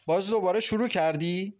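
an understated fearful challenge, rising at the end; trembling voice. A narrator's reading. Farzaneh short of breath facing his advances, mildly trembling. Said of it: "با صدای لرزانی گفت".

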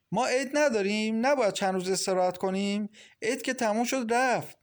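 high, aggrieved questioning; indignant protest. A narrator's reading. Woman arguing for a few days' holiday rest, indignant. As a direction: push back indignantly, pitch jumping up. Acted quarrel, tenant protesting.

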